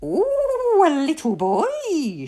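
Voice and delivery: high, gloating villain's coo; wicked, delighted fairy voice; grinning bad fairy. A narrator's reading. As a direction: swoop from a high ooh into relish. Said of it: "she grinned".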